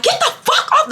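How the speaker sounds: high-pitched mock fury; imitated mad cursing. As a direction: play an old man yelling people away. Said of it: "So he's getting mad".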